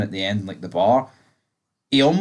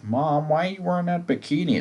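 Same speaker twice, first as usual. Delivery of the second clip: put-on whiny teen voice pitched up; mock a mortified kid complaining; embarrassed son; 6 semitones higher, 4 dB quieter than usual. Son whining to his mum about her bikini.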